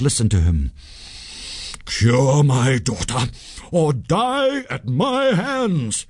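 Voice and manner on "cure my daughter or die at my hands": slow, menacing high cry; terrible merchant's threat, slow and rising high. A narrator's reading. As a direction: threaten death, drawing out every word. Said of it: "he cried in a terrible voice".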